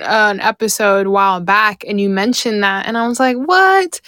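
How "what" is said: surprised what. Retold or imitated. Recalls first seeing the show.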